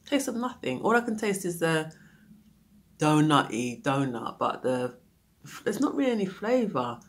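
ordinary talk

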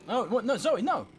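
high, loud cries of protest; dismayed shouting. Real, not acted. Player to his character Zoe, dismayed shouting. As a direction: cry out as it all goes wrong. Game character comes to grief.

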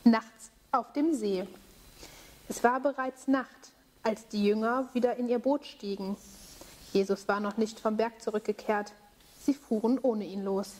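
calm storytelling voice; story narration for children. A narrator's reading. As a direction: tell the tale to kids gently. Bible story told to children.

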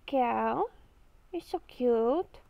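usual speaking voice

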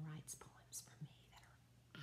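hushed, confidential whisper; whispered aside. Real, not acted. Wife confiding to class about husband's poems, in a whisper. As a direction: drop to a whisper, as if letting slip a secret.

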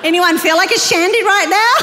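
loud, breaking into real laughter; laughing call-out. Real, not acted. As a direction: call it out to the crowd, about to crack up. Joking with congregation, laughing hard after.

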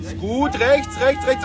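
high shouted directions; sideline yelling. Real, not acted. Sim landing, steering shouts.

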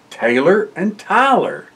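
plain reading voice

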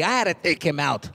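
usual speaking voice

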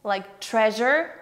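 slow treasure, leaning on the zh sound. Shown as a demonstration. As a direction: stretch the zh in treasure. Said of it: "Ж".

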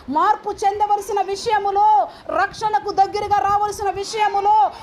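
high, chant-like pulpit cadence; full-force preaching. Real, not acted. Preacher listing what should shame them, high rhythmic preaching. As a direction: build a list, stretching each repeated ending high.

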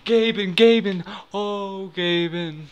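high, loud calling of the name; real urgent calling as the wheel spins; excited calling out. Real, not acted. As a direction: call out to him in rising hope. Begging Gaben during spin.

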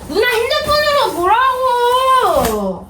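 put-on high, drawn-out childish whine; whining protest. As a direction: wail the complaint, dragging it out. Kid protests over his tablet.

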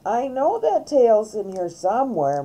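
ordinary delivery, nothing marked

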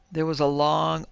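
ordinary delivery, nothing marked

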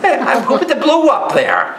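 high-pitched, hammed-up challenge; incredulous comeback. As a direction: fire back, voice jumping up high. Retold comeback to the atheist.

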